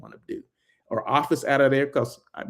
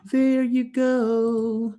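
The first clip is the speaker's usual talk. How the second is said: "There you go" stretched very slow and much higher; drawn-out sing-song; 11 semitones higher, much slower than usual. Acted. Vlogger closing his bar tour for viewers, drawn-out sing-song "There you go". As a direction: sing "There you go" in a long playful drawl. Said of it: "So there you go".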